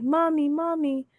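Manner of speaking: put-on high, drawn-out toddler call; imitated toddler. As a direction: mimic a little one calling for mom, slow and high. Imitating two-year-old calling mother.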